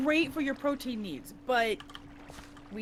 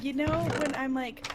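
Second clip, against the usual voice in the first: normal talking voice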